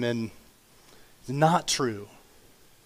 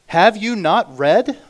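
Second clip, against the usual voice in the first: slow, louder, higher rebuke; Jesus rebuking; 6 semitones higher, 8 dB louder, slower than usual. Acted. Jesus to Pharisees on divorce, slow rebuke. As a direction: let Jesus challenge them slowly.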